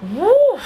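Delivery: a high, loud whoop of relief; celebratory woo. Real, not acted. Vlogger celebrating surviving the year, high whooping. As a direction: let out a whoop of triumph.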